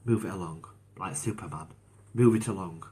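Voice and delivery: ordinary talk, nothing marked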